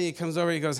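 ordinary delivery

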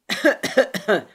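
loud hollow coughing fit; booming cough. A narrator's reading. Kostas coughs over the creaking floorboard, booming cough. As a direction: cough heavily and resonantly. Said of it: "Гулко раскашлялся Костас".

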